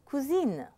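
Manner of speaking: "cousine" in French, ending een with sounded N; feminine ending. Shown as a demonstration. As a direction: finish "cousine" on a clear N. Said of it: "The feminine is".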